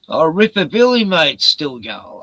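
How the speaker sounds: loud, high, happy hello to a pet; light up and call out to him, pleased; delighted greeting. Real, not acted. Greets an animal that appears.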